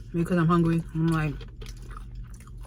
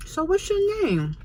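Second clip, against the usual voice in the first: slow, high-pitched put-on come-on; stretched, lifted mock chat-up voice; imitated flirting man; 11 semitones higher, slower than usual. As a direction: mimic a guy drawing out his pickup question. Voices the man chatting her up.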